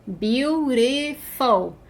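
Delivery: "Beautiful" stretched syllable by syllable; very slow, separated "Beautiful"; drawn-out syllables. Shown as a demonstration. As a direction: draw out "Beautiful" to show its length. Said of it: "é um adjetivo comprido".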